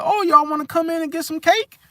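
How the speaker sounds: high-pitched mock invitation; coaxing auntie. Aunt tempting the friends inside with cake, high and coaxing. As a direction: lure them in with a very high auntie voice. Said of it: "the Cancer be like".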